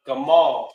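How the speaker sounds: "gamal" as guh-MAHL; pronounced "gamal". Shown as a demonstration. Teacher gives the word to repeat, sounding out "gamal". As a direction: model "gamal" with a long second syllable.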